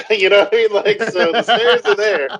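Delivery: high giggling while talking; laughing. Real, not acted. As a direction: laugh through the words. Laughing through explanation.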